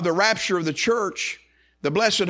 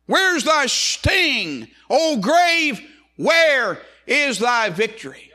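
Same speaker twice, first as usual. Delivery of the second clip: high, loud, drawn-out challenge; slow, ringing preacher's taunt; addressing death; 12 semitones higher, 6 dB louder, much slower than usual. Acted. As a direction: shout defiance at death from the pulpit. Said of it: "he's addressing death".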